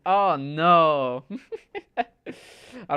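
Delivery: laughing sympathetic oh no. Real, not acted. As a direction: laugh while feeling bad for them. Reacting to chat message, laughing.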